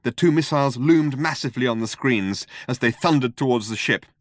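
usual speaking voice

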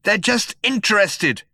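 high, loud insistence; shout. A narrator's reading. Zaphod insists to nervous companions, shouting. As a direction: shout the excuse down at them. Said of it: "shouted Zaphod".

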